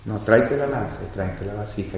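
David holding Abisai back, quiet and hushed; hushed command. Retold or imitated. David to Abisai in the sleeping camp, hushed and urgent. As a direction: keep it low so nobody wakes. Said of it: "Y le dice David".

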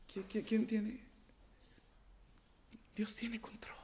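lightly voiced mock question; mock high voice. Preacher in put-on high voice.

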